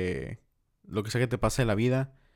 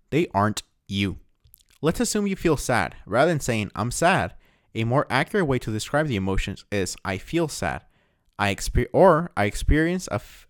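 reading aloud; 4 dB louder than usual. Acted. Podcaster reading a book passage to listeners, read out with emphasis. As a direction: read it out like a self-help passage. Reads a long English passage.

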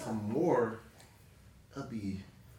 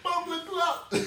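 mock Jamaican; slower than usual. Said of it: "you heard the accent".

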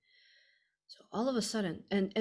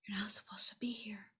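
hushed warning whisper; girl whispering a warning to a boy; 3 semitones higher, 6 dB quieter than usual. Retold or imitated. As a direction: warn him gently without a sound of voice. She warns the boy secretly.

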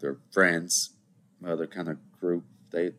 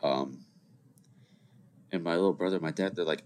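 ordinary talk

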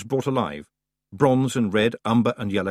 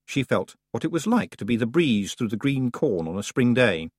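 ordinary delivery, nothing marked

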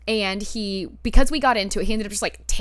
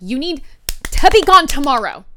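much louder and higher retold order; forceful, punched-out demand; emphatic repeated order; 5 semitones higher, 10 dB louder than usual. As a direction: raise the voice to make him hear it. Repeats order after he says what.

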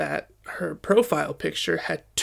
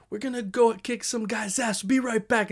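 mock yell, pitched up; higher, half-hearted retold shout; dad yelling out; 5 semitones higher than usual. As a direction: imitate a father calling out on his way out. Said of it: "my dad just yells out".

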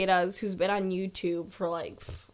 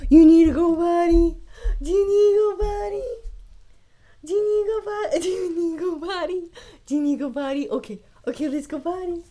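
pet talk voice; 6 semitones higher, 7 dB louder, faster than usual. Real, not acted. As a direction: owner cooing at her dog about going potty. Talking to the dog in pet voice.

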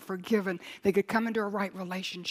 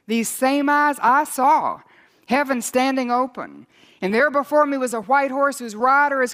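loud, high fervent preaching; thundering revival preacher; preaching at full force; 4 semitones higher, 9 dB louder than usual. Acted. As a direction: build the congregation to a roar.

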